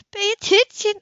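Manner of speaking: high, loud urgent order; alarmed scolding. A narrator's reading. Woman on the boat, loudly scolding him to steer.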